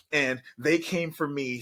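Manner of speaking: ordinary talk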